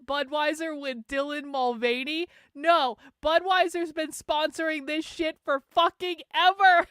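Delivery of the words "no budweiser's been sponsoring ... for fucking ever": exasperated outburst. Real, not acted. Streamer exclaims in disbelief at conservatives.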